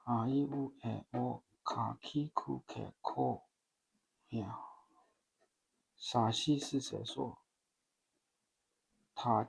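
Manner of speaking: quiet, measured kana recitation, one sound at a time. Shown as a demonstration. Learner practicing the kana chart alone, quiet measured drill. Second pass reciting syllabary.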